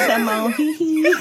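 high, giggly pop-star catchphrase impression. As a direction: squeal it playfully through a laugh. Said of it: "like M J".

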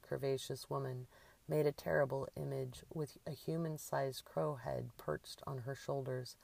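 usual speaking voice

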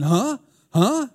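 imitating someone who didn't catch it; hard-of-hearing husband. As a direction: ask as if you missed what was said. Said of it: "because I'm hard of hearing".

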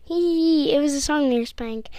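loud, fast gleeful reveal; excited reveal. Real, not acted. Telling friend it was a prank, loud fast glee.

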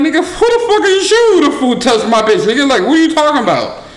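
retelling the moment he lost it; angry. Retold or imitated. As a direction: blow up at him in disbelief. Heated confrontation retold.

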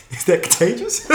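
high mock alarm breaking into laughter; laughing. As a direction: ask it in fake shock, then crack up. Joking mock shock, big laugh.

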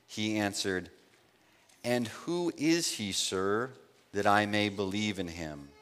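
soft, hushed Bible reading; scripture reading. Acted. Preacher reading John to the congregation, hushed reverent reading. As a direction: read the passage quietly and reverently. Reads scripture passage softly.

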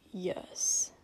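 short whispered yes; curt whisper. Acted. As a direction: clip it, wary. Suspicious hungover prince.

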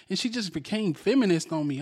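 ordinary talk

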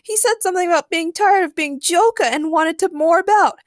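high, loud, plaintive Harley wail; mock sobbing. A narrator's reading. Harley recalls Joker's words, wailing plaintively. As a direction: keep wailing in a put-on high voice. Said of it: "sobbed Harley".

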